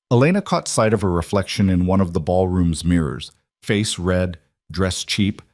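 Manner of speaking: ordinary delivery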